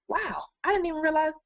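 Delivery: high, wide-eyed wow of discovery; suddenly amazed at her own list; surprised realization. Retold or imitated. Coach retelling her own surprise at a forgotten goal, high and amazed. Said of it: "And I'll go".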